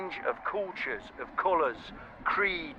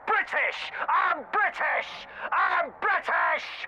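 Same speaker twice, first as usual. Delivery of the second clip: high, slow, stretched shout; 10 semitones higher, much slower than usual. Retold or imitated. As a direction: bellow it out proudly and slowly. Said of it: "Just going".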